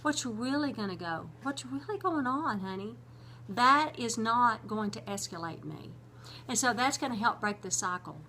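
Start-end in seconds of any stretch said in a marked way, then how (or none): 0.0-3.0 soft, soothing, a little higher; calming, not yelling. Retold or imitated. Husband soothing his yelling wife, soft and gentle. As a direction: gently talk a partner down. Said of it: "one of those two is gonna make me more angry".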